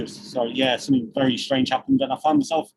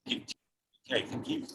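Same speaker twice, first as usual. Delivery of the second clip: normal talking voice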